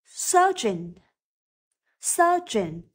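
Surgeon starting on a voiceless s; careful model Surgeon with a light initial s; light s sound. Shown as a demonstration. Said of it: "âm đầu tiên phát âm là s". (phát âm Surgeon với âm s nhẹ)

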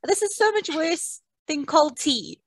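high, slow, pained groan; flustered complaint. Real, not acted. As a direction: wince through the words. Burnt by hot tea.

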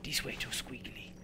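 whispering while searching the way. Acted. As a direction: half-whisper it to yourself.

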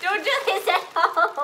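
helium voice. Real, not acted. Helium outro.

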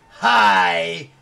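a sudden loud, low "Hi!" like a chain-smoking granny; loud mock old-lady greeting; old smoker lady. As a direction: bark a loud gravel-voiced hello as an old lady. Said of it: "an eighty-year-old lady that's been smoking".

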